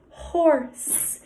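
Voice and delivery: normal talking voice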